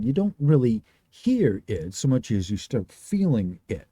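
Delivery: normal talking voice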